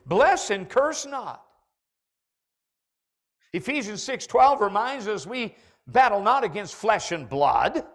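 loud, rising preacher's charge; preaching at full force. Acted. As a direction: drive the challenge home to the church.

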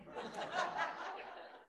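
high airy laugh at his own punchline; high breathy laugh. Real, not acted. Preacher laughing at his own lighthouse joke, high and airy.

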